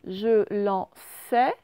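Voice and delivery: Je lançais said very slowly with a rise; slow dictation. Shown as a demonstration. Teacher slowly sounding Je lançais for pupils. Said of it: "c'est dans la dernière syllabe".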